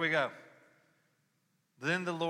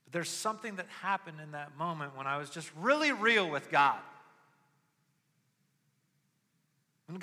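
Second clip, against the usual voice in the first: higher and louder preaching build; impassioned preaching; 8 semitones higher, 8 dB louder than usual. Acted. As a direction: preach it from the gut. Preaching at full force.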